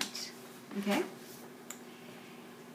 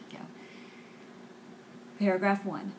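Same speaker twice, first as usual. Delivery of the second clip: normal talking voice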